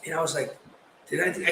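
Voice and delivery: ordinary delivery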